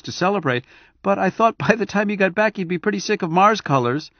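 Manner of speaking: high, quick chatty wife voice; chattering wife. Acted. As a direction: rattle on brightly. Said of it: "she chattered".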